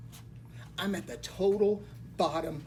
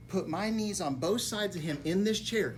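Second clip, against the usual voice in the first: ordinary delivery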